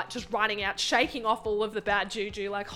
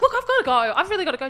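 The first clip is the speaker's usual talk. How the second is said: flustered excuse; 8 semitones higher, 7 dB louder, much faster than usual. Retold or imitated. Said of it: "so I'm like".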